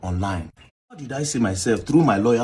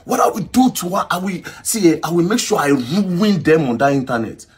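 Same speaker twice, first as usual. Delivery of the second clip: re-enacted scream; 7 semitones higher, 4 dB louder than usual. Man threatening whoever posts his picture, re-enacted high-pitched scream. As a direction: scream the threat, starting very high and easing slightly. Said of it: "then I screamed".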